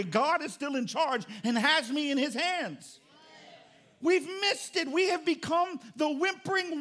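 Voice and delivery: fired-up pulpit delivery; preaching at full force. Acted. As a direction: hammer each question at the congregation.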